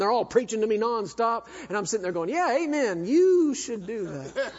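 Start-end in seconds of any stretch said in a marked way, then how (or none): 2.3-4.5 laughing mock amen. Mock agreeing with grandfather.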